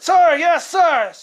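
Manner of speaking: shouted sir yes sir; barked military recruit reply. Retold or imitated. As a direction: yell back like a scared recruit. Recruit answering drill sergeant.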